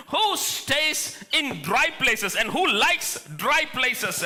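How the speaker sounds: drawn-out, slow rhetorical question; slow quoted question. Acted. Pastor slowly voicing Jesus' question to the church. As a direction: let each word of the question hang.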